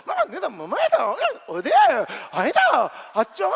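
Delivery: loud, high Gyeongsang chatter, shouted; mock-shouted Busan dialect family chatter. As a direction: imitate wife and kids babbling in Busan dialect. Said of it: "막 이러고 있는 거야".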